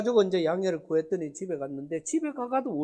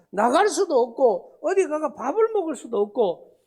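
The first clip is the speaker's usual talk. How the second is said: usual speaking voice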